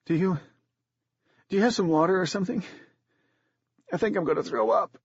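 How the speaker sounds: put-on a young girl about to be sick; queasy girl. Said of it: "her face had gone ashen, and she swallowed hard".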